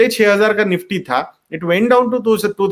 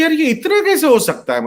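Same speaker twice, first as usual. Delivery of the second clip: mildly incredulous, higher-pitched quote; retold disbelief; 8 semitones higher than usual. Investor marvelling at own SIP returns, retold disbelief. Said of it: "उनको यकीन ही नहीं हो रहा था".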